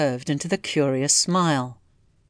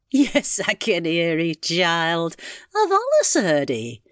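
put-on old country aunt; 2 semitones higher, slower than usual. Aunt admitting to Mary she always heard, in character. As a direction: confide it as the amused old aunt.

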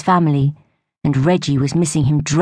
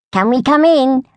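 higher call through the door; 10 semitones higher, 4 dB louder than usual. A narrator's reading. Friend at the door calling inside. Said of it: "a voice called".

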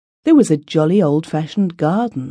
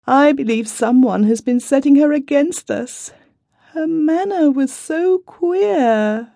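high, plaintive complaining voice; a distressed wife pouring out her worries; tearful young wife; 10 semitones higher than usual. A narrator's reading.

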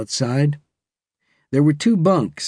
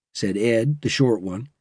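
ordinary delivery, nothing marked

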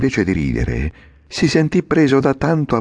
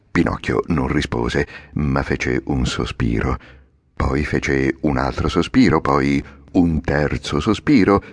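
usual speaking voice

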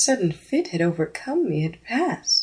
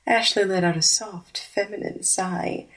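ordinary delivery

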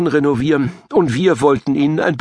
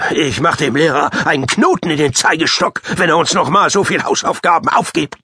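mock threat; 3 semitones higher than usual. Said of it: "drohte unser Klassenrambo".

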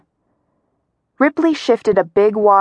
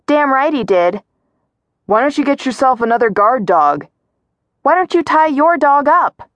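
raised, higher-pitched yelling; heated quarrel; 6 semitones higher than usual. A narrator's reading. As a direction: shout back and forth across a road. Shouted quarrel across a road.

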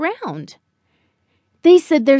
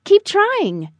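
higher, lilting motherly voice; encouraging mother; 6 semitones higher than usual. A narrator's reading. Mother to Buddy as he improves, brightly encouraging. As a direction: cheer him on, higher and falling at the end. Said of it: "Mother said".

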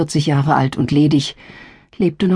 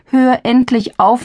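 exasperated; 8 semitones higher, 3 dB louder, slower than usual. Acted. Daughter scolds matchmaking mother.

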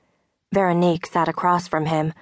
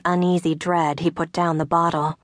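ordinary delivery, nothing marked

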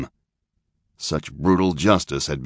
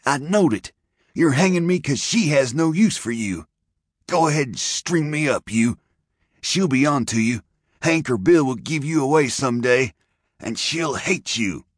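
put-on much higher, furious young rider; 9 semitones higher than usual. A narrator's reading. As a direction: rage at him from the noose. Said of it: "burst out the bound rider furiously".

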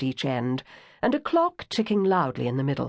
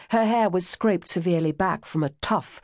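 usual speaking voice

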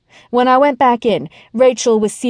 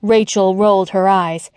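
plain reading voice

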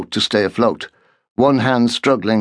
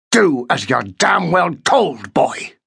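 slow, raised furious order; angry command; 5 semitones higher, slower than usual. A narrator's reading. Markham orders the boy, slow and furious. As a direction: lean in and force every word.

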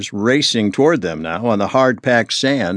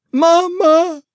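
put-on high, loud little girl's sob; a frightened girl crying out for her mother; crying girl runs to her mama; sobbing child; 4 dB louder than usual. A narrator's reading. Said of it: "she sobbed".

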